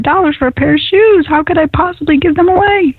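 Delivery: rising, high-pitched disbelief at the cost; mock protest. Acting out her own protesting thought.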